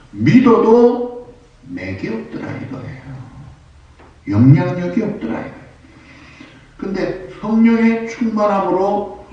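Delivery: high-pitched, emphatic pulpit delivery; preaching. Real, not acted. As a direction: drive each phrase home to the congregation. (높은 어조로 힘주어 설교하듯)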